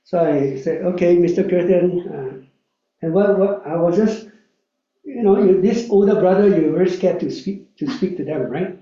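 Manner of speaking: normal talking voice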